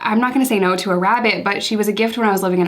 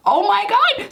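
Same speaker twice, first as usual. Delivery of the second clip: much higher, retelling a stunned "oh my God"; shocked gasp; 12 semitones higher, slower than usual. Retold or imitated. Retelling hearing the dog ate the bunny, high shocked gasp. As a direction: let the words jump up high in alarm. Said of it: "And I'm like".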